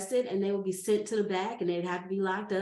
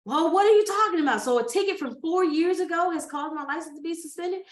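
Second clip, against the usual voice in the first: high, loud and outraged; exasperated; 7 semitones higher, 7 dB louder than usual. Retold or imitated. Driver arguing with the cop, loud outrage. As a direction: protest the ticket in disbelief.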